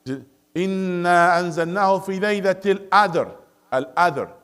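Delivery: Lebanese-style "الْقَدْرِ" as "Adr", qaf dropped; Lebanese accent. Shown as a demonstration. Teacher imitates a Lebanese reciter, Lebanese accent. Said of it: "Lebanese, he will recite it".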